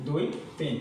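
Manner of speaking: usual speaking voice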